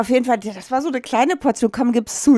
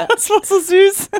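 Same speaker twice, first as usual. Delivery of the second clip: high, slow, melting voice; gushing over something cute; touched, high; 11 semitones higher, 4 dB louder than usual. Real, not acted. Gerührt über süßen Jungen. (hoch und langsam, gerührt)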